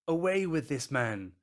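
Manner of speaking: high, forceful shout; crying out. Acted. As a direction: cry out as one angry mob. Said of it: "With one voice they cried out".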